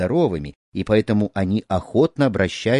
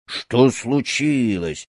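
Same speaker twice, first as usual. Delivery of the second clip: slow, surly growl of a question; gruff, unfriendly grunt; much slower than usual. A narrator's reading. Snake charmer eyeing an uninvited boy at his door, unfriendly grunt. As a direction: grumble it unwelcomingly, drawn out. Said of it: "недружелюбно буркнул он".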